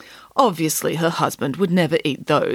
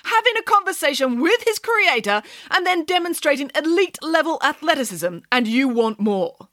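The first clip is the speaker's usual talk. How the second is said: higher, huffy pig nanny; indignant Nanny Piggins; 10 semitones higher than usual. A narrator's reading. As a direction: sound grandly offended. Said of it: "asked Nanny Piggins".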